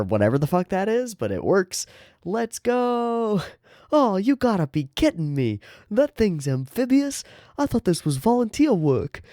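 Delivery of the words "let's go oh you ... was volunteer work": high, whiny New York complaint; New York accent. Acted. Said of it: "I apologize to anybody from New York".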